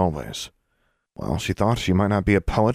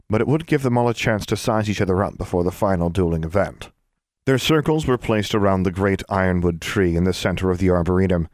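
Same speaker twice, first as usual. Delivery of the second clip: ordinary delivery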